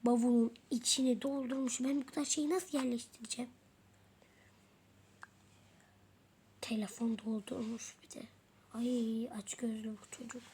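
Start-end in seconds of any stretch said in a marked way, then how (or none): 1.8-10.4 grumbling whispered aside; muttering to yourself, annoyed. Real, not acted. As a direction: complain under the breath. Grumbling aside about the girl.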